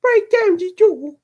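very high, loud scream; piercing boyish yell; boy's scream. A narrator's reading. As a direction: scream the order like a frantic child. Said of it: "screamed the boy".

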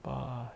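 drawn-out fake child's bawl; mock crying wail. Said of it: "he's crying".

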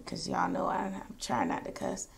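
whispered aside. Real, not acted. Telling viewers she avoids cussing, whispered aside. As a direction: lean in and confess it quietly.

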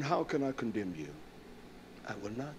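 low, soft, sinking voice; quietly absolve a weeping friend; hushed, tender retold reply; gentle reassurance. Retells gently forgiving weeping friend.

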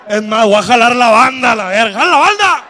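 loud holler, rising high at the end; calling out. Acted. Ezequiel summoning the band to win Galaxia back, building loud shout. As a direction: yell for the band to come over.

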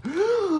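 high, loud theatrical gasp; sharp mock gasp. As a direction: gasp in fake shock. Dramatic gasp.

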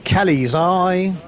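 loud, high, slow bingo shout; bingo caller. Pretend. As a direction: call it out big and slow. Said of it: "a shout out".

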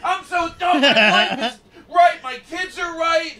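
high, loud yelling at himself; self-berating shout. Pretend. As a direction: melt down loudly over a flubbed line. Improvised actor berating himself.

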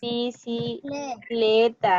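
Bicicleta stretched out very slowly, syllable by syllable; teacher modeling Bicicleta for the class, very slow; very slow syllable demo. Shown as a demonstration. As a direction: drag out Bicicleta to find the stressed syllable. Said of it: "Cuál vendría siendo la sílaba tónica".

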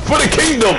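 high, loud charge cry; rally for the kingdom; hammed-up warrior cry; mock battle cry.

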